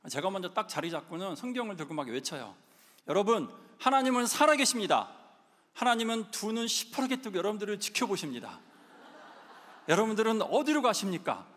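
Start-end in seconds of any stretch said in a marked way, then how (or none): 3.1-11.5 loud, fervent open-air preaching call; street preaching shout. Retold or imitated. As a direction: proclaim it to passing students at the school gate. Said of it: "하면서 막 이렇게 막 외쳐요". (교문 앞에서 학생들에게 외치는 노방 전도 외침)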